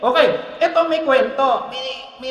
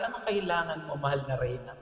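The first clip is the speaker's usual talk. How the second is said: king's gentle question; 7 semitones lower, 10 dB quieter than usual. Retold or imitated. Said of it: "Pero sinabi ng hari".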